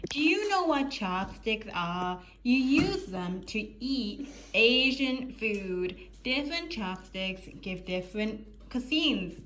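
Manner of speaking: put-on slow, stretched-out TikTok explainer accent. Vlogger explaining chopsticks to viewers, slow TikTok accent. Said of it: "I give myself TikTok accent".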